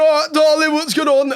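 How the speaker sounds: put-on high, loud drunken tourist voice; loud English holidaymaker. Comedian mocking a loud English tourist on holiday, high and loud. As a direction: play the obnoxious Englishman abroad, loud and high. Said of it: "you see an English guy being like".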